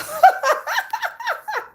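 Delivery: high-pitched giggling run; real laughter. Real, not acted. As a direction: burst into high, rapid laughter. Laughs after fond quote.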